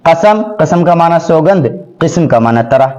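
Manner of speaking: ordinary delivery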